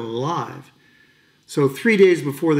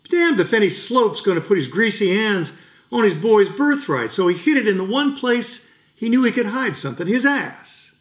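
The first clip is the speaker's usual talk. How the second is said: higher-pitched, worked-up retelling; indignant defiance; 7 semitones higher than usual. Acted. Veteran tells a boy of his father hiding the watch, indignant. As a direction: tell it with rising indignation.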